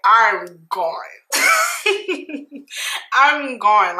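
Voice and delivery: giggly admission; tipsy giggling; laughing. Real, not acted.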